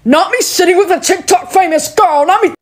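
a hint of a snide classmate; mocking classmate. Classmate teasing the TikTok famous girl, mock high voice.

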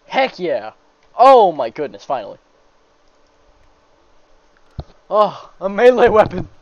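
excited. Real, not acted. Still thrilled by weapon.

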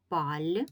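"паль" alone as a drawn-out syllable; isolated syllable. Shown as a demonstration. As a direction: sound out "паль" as one separate beat. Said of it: "це перший склад".

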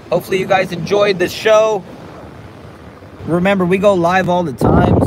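high, loud wrap-up to the chat; hyped outro. Real, not acted. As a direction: pump up the viewers before signing off. Wrapping up the stream.

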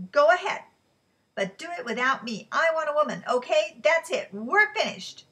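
loud, high, final shouting; furious shout. Acted. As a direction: end it for good, yelling. Quarrel ends with a breakup.

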